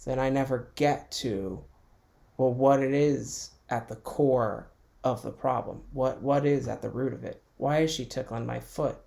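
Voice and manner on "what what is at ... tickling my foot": ordinary talk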